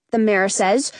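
ordinary delivery

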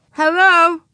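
high, loud greeting with a hint of mouthful; lightly voiced child chirping through a bite; little girl, mouth full. A narrator's reading. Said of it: "said Ramona through a bite of apple".

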